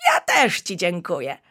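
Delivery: usual speaking voice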